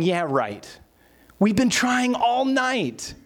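acted exasperation, rising high and drawn out; scoff, then stretch the complaint out slowly; weary mock protest at unwanted advice; scoffing disbelief. Disciples scoff at Jesus's advice.

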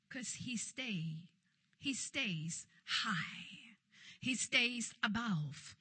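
low, slow, hushed preaching; hushed emphasis. Real, not acted. Preacher tells congregation why evil cannot touch, hushed emphasis. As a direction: drop right down and draw each word out.

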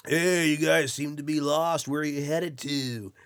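high, loud laid-back surfer dude; surfer bro voice. Retold or imitated. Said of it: "in the most surfer bro voice you can imagine".

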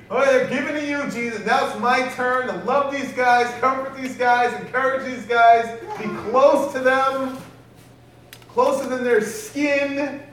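loud, rising, eager excitement; the Holy Spirit claims his turn with relish; taking over with excitement, building as it goes. Acted. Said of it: "Holy Spirit's like".

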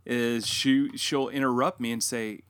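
normal talking voice

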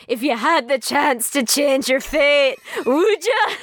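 put-on Darnley wooing Mary in a Scottish accent. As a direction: roll it like a romantic Scottish suitor. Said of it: "Can you please demonstrate?".

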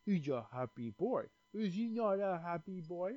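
soft cooing to a pet. Real, not acted. Owner fussing over his happy dog, cooing. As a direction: coo softly, as if to a beloved dog.

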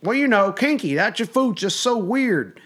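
high, drawn-out imitation of dad; put-on dad voice, slow and high; dad impression. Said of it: "And he's like".

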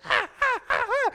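high yelping baby cries, imitating; hammed-up infant freaking out; mock baby wail. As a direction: imitate a frantic baby wailing. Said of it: "just, like, freaking out".

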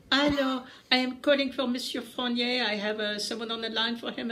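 put-on high French-accented operator voice. Paris operator calling for Monsieur Fournier, high French accent. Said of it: "that was a really good accent".

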